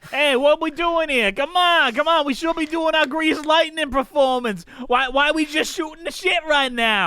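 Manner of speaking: high, loud mock-impatient heckling; hammed-up impatience, come on let's go; loud playful nagging; mock impatient shout. Mock-impatient call to perform.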